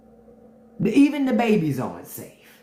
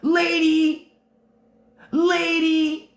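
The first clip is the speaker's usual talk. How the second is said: drawn-out, higher mocking call of "lady"; mock sing-song "lady"; 8 semitones higher, much slower than usual.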